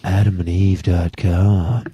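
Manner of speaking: slow, breathy come-on read; sultry ad voice. Retold or imitated. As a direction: purr the web address like a sexy ad. Mimics sexy ad voice.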